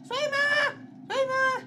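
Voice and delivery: very high, loud repeated shriek; panicked scream. Real, not acted. Player panics in game, screaming. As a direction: scream it in alarm.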